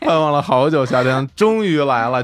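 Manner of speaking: high laughing talk. Real, not acted. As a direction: laugh through the words. Laughing over gift exchange.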